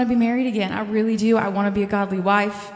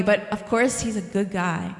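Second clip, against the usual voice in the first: normal talking voice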